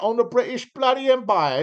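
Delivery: mock British accent on bloody empire. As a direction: boast of the empire like a proud Brit.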